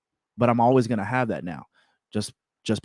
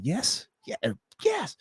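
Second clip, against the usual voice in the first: excited yes; 9 semitones higher, 6 dB quieter than usual. Retold or imitated. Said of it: "And I'm like".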